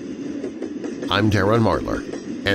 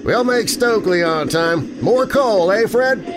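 high, hearty call over the engine; rally your mate to shovel; cheery train engineer; 12 semitones higher than usual. Acted. Engineer calls to fireman Fred.

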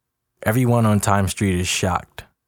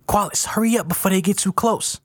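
high, pressing voice; high-pitched urgency; urgent urging; 12 semitones higher than usual. A narrator's reading. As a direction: hurry a friend before danger arrives. Bessie urging him into trunk.

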